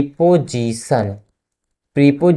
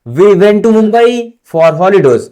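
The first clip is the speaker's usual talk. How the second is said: very slow and loud, word by word; slow dictation to a class; 5 semitones higher, 7 dB louder, much slower than usual. Shown as a demonstration. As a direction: spell it out for students writing along. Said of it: "dictating an example sentence slowly".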